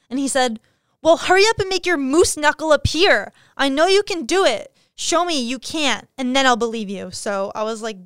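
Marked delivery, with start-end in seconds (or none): 1.0-7.1 higher, louder voice for a pushy guy's demands; mocking pushy guy. She mocks a guy demanding she pull up sweatpants, higher and louder. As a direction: needle her like a smug creep insisting he's right.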